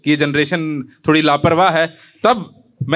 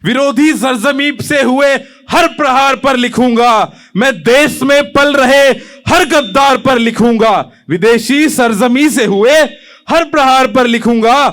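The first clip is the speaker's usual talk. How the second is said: higher, insistent, hammered declamation; impassioned poem recitation; 7 semitones higher, 6 dB louder than usual. Acted. Young poet denouncing traitors to the hall, impassioned. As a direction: drive each phrase out as a challenge.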